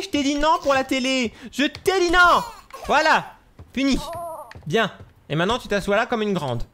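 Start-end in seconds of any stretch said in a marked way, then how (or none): 0.0-4.0 scolding. Pretend. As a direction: tell the baby off, building up. Gronde le bébé. (gronder le bébé de plus en plus fort)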